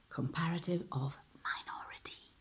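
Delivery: soft, whispery topic reveal; hushed announcement. Shown as a demonstration. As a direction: drop to a whisper to introduce the topic. Hushed announcement, then louder repeat.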